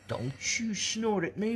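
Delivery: high, slow pet-voice scold; mock stern pet talk. Real, not acted. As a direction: tease the dog in a squeaky voice. Teasing the dog.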